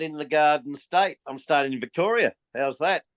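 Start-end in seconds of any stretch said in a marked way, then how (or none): none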